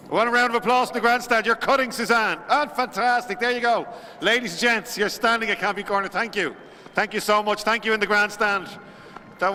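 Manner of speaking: rally the grandstand for applause; big, high-energy ceremony call; excited announcer. Real, not acted. Announcer hyping crowd at ribbon cutting.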